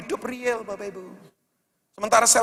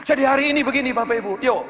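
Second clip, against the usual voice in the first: loud, driving sermon climax; rally the congregation to shout amen; rousing preaching; 5 semitones higher, 6 dB louder than usual. Acted. Preacher rallies congregation loudly. (khotbah lantang membakar semangat jemaat)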